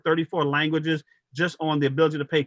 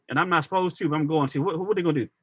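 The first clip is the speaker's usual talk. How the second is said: normal talking voice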